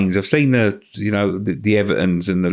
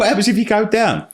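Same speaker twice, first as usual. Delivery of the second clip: mock-worried question, pitched well up; imitating panic; 13 semitones higher than usual. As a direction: ham up the nervous Tottenham player asking. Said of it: "he's fucking panicking".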